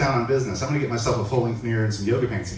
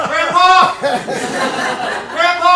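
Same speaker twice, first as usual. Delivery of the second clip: very high, loud calling for a lost dog; 7 dB louder, slower than usual. Pretend. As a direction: holler across the neighbourhood. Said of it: "be like".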